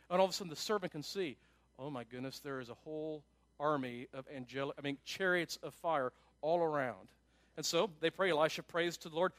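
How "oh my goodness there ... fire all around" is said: low, soft acted amazement; hushed amazement. Servant suddenly sees chariots of fire, in hushed amazement. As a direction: take it in quietly, voice dropped low.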